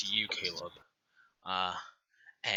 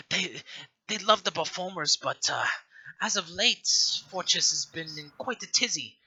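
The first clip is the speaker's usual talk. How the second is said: put-on lightly higher old woman, a bit sad; a hint of wistful old matron; 7 semitones higher than usual. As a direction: sigh about the empty orphanage. Matron laments few visitors.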